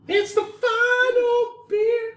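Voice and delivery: high, slow fake crying wail; mock wailing. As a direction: wail it like a tragedy. Mock lament over last beer.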